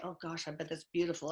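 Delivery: ordinary talk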